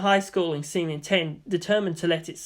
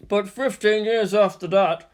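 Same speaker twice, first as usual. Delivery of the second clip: lightly voiced teacher, a touch louder and formal; teacher lecturing; 3 semitones higher, 4 dB louder than usual. A narrator's reading. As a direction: recite history to a class.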